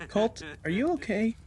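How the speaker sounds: concerned. Acted. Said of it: "genuinely concerned".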